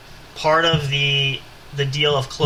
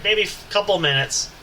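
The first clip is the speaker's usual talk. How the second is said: ordinary delivery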